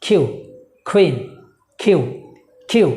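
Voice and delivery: কিউ and কুইন with an aspirated k; correct pronunciation. Shown as a demonstration. Teacher drilling letter and example word, aspirated k. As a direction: puff air on the k of কিউ and কুইন. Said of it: "the correct one is".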